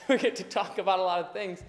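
ordinary delivery

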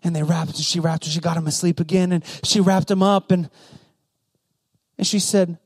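ordinary delivery

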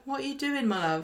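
soft, high start dropping away at the end; gently imitated motherly question; just said it. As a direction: say it softly, like a bewildered mum. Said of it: "she just said it and she's like".

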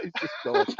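high wheezing laugh through the words. Real, not acted. Cracking up with friends, laughing. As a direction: laugh too hard to talk.

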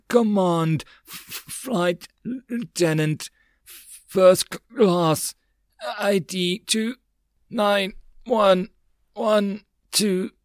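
slow, high, halting strained voice; struggling word by word, stuttering and weak; parched whisper. A narrator's reading. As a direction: stammer it out with a parched throat. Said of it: "He could only hospitably whisper".

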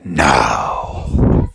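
slow, drawn-out read-along host; storybook recording intro. Acted. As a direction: announce the tale with stretched words.

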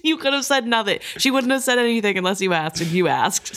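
a hammed-up scornful captain, fast; mocking Kennet. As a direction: send up the captain with a laugh. Mocking Kennet's rude reply.

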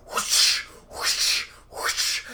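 breathy whooshing lash sounds, voiceless; imitated whip whoosh. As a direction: mimic a whip cracking through air. Ghost lashes with whip.

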